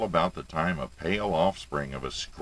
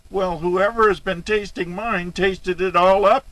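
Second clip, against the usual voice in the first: very high, loud little bear cry; baby bear voice; 7 dB louder than usual. A narrator's reading. Baby bear finds his stew eaten, high tearful cry. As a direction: wail it like a poor little cub. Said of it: "in his baby bear voice".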